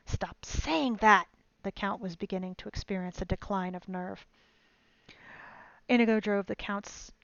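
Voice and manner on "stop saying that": loud, slow, drawn-out outburst; exasperated shout. A narrator's reading. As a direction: snap it out slowly and loudly. Count shouts at Inigo.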